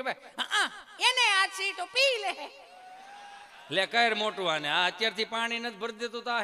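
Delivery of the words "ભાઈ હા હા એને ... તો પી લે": put-on much higher, slow child voice; a lazy kid brushing it off; cheeky child. Chintu voiced in a story. (ઊંચો, ધીમો, આળસુ બાળકનો અવાજ)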